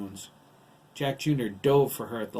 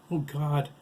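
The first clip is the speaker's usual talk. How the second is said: gasped exclamation, raised a little; alarmed, higher and louder; frightened gasp; 5 semitones higher, 4 dB louder than usual. A narrator's reading. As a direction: gasp it as you're about to fall. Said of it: "Kate gasped".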